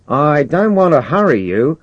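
high, loud, coaxing call; persuasive commander. Acted. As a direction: coax him from far below. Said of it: "said Norton's persuasive voice".

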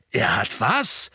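slowed stunned he did what; shocked cry. A narrator's reading. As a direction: hit was with disbelief, rising. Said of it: "rief Sirius".

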